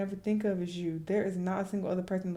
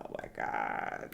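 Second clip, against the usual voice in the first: whispered, slow, lower; breathless whisper; 6 dB quieter, slower than usual. Real, not acted. Stunned by the butterflies line, whispered. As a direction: gasp it out in disbelief.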